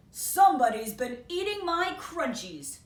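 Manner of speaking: loud, indignant dad voice; indignant father. Acted. Father bear discovering his crunchies eaten, loud and indignant. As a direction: complain loudly like an upset father.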